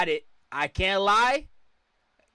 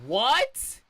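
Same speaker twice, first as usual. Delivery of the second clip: high, rising "what?"; stunned and pitched up; disbelieving outburst; 7 semitones higher than usual. Real, not acted. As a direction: blurt it out in real disbelief. Reacting in disbelief to video.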